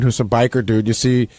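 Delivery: ordinary talk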